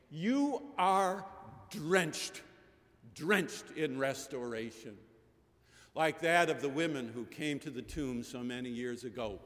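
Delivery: usual speaking voice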